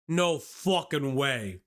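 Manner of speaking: drawn out, slow; slow disbelief. Real, not acted. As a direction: stretch it in disbelief. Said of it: "I have a carrot in my mouth".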